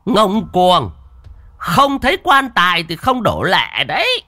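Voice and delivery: high, loud shaman's rebuke; indignant high-pitched threat; outraged shout. A narrator's reading. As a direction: scold the insolent spirit at high pitch.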